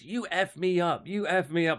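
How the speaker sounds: hammed-up blaming jab; mock accusation. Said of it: "She goes".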